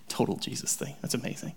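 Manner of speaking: awed whisper. Real, not acted. Awed aside.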